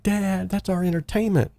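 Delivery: high mock crying plea to her father; whiny pleading daughter, lightly imitated; Hannah crying. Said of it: "Hannah screaming and crying".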